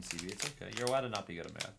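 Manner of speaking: ordinary talk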